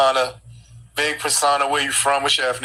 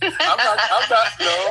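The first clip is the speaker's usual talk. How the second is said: laughing through the words, higher; laughing denial; 6 semitones higher, slower than usual. Real, not acted. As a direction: deny it while cracking up. Host laughs denying insult.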